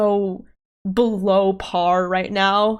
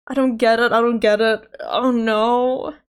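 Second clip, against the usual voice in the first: ordinary talk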